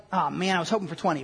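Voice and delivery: low, quick grumbling aside; mock disappointment. Revived man hoping to be younger, mock disappointed mumble. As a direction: grumble it lightly, fast and low. Voices revived Lazarus joking.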